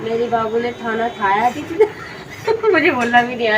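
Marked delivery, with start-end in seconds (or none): 0.0-1.6 put-on awkward baby-talk lisp, giggling; lower, half-hearted mimicked lisp; lisping imitation. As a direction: attempt the lisp through laughter. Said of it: "मुझे बोलने में अजीब लग रहा है".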